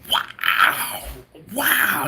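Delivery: a touch of stunned delight; imitated excited wow. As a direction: replay the father-in-law's impressed gasp, lightly. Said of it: "He was excited".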